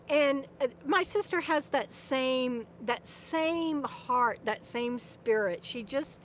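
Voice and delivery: usual speaking voice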